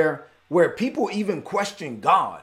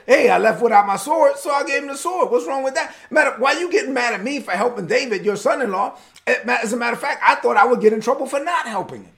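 higher-pitched, animated self-defence; indignant protest; 6 semitones higher, 4 dB louder, faster than usual. Acted. Priest defending himself to the angry king, acted indignant protest. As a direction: plead your case with mock exasperation.

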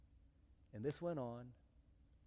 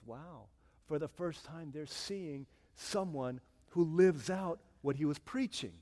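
ordinary delivery

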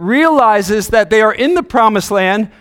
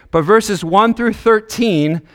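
ordinary talk, nothing marked

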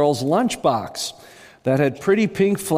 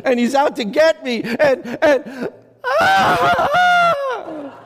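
much higher and louder, rising into a terrified scream; panicked screaming; 11 semitones higher, 3 dB louder than usual. A narrator's reading. Nervous man at the front panics about his box, screaming. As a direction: work yourself into panic until you break into a scream. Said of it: "The screaming man leaped from the platform".

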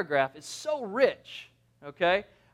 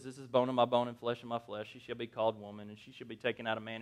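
low, quiet, rushed recitation; boring read; 8 semitones lower, 11 dB quieter, faster than usual. Shown as a demonstration. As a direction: rattle it off dully, dropping low. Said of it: "it sounds kind of boring".

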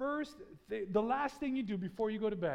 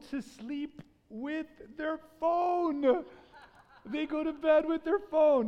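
emphatic exclaim; 7 semitones higher, 7 dB louder, slower than usual. Real, not acted. Preacher stressing bad statistic.

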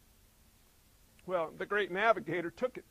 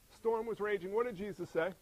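ordinary talk

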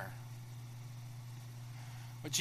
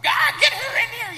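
much higher, loud frantic shout; worked-up shout; 10 dB louder than usual. Acted. Preacher voicing a frantic Martha shouting for her sister. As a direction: yell it, all worked up. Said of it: "she's been so worked up".

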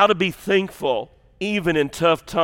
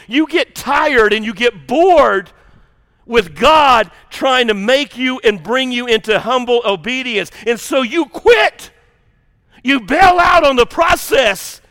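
louder, higher, slow shouted preaching; preaching at full force; 4 semitones higher, 4 dB louder, slower than usual. Real, not acted. Preacher warning the church about quitting, shouted. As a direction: drive it home to the congregation.

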